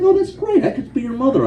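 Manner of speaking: high, laughing impression of an older woman teasing; mock older woman. Older woman tells the captain she could be his mother, high and laughing. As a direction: squeal it like a tickled older lady. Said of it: "and she's like".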